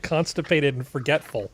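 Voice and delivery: ordinary delivery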